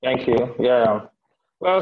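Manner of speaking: normal talking voice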